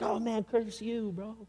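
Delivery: mock exasperation at another driver; muted road rage. Mock road rage while driving.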